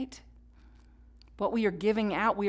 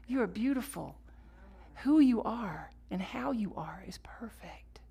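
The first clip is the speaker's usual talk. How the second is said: tender whisper; 6 dB quieter than usual. Retold or imitated. God comforting her as his baby girl, hushed and tender. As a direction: breathe it gently like a blessing.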